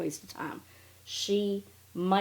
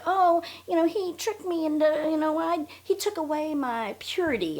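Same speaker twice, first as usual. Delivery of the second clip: much higher put-on whiny voice; mocking Robin; 9 semitones higher than usual. Quoting Robin's purity complaint, high mock whine. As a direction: parody her wounded-purity story. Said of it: "I think was the word she used".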